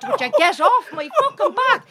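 high re-enacted complaint with laughter; exasperated protest. Client pinned under masseuse, high exasperated protest. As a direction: protest at her, voice shooting up. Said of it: "I'm like".

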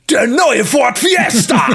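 mock TV ad announcer booming; blaring ad announcer. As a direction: belt it like a car commercial breaking into silence. Loud ad interrupts ASMR video.

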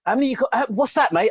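high, lively mock customer voice; mimicked eager customer. Record shop punter asks the clerk, high mock voice. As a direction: pitch it up like a keen punter at the counter. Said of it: "They'll go".

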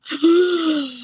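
sharp high gasp; a sudden startled gasp. Real, not acted. Shocked gasp before new topic.

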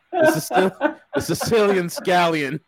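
giggling through the words; laughing. Real, not acted. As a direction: crack up mid-name. Laughs through the guest's nickname.

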